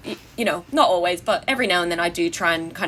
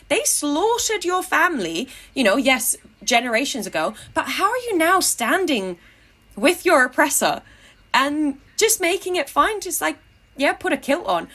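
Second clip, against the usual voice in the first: impassioned, high-pitched reproach; indignant moral appeal; real indignation; 6 semitones higher than usual. Real, not acted. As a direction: challenge them in disbelief, voice raised in pitch. Indignant challenge to kilted Scots soldiers.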